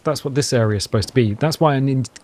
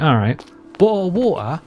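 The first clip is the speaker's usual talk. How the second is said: "bottle of water" with London dropped t's, "bo'le of wa'er"; London accent; 4 semitones higher than usual. Shown as a demonstration. Viewer asked him to say it, London accent. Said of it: "No, that's not my accent".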